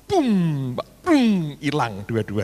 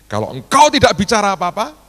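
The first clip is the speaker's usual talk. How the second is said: exasperated prayer; 8 semitones higher, 10 dB louder than usual. Retold or imitated. Retold prayer, surrendering to God.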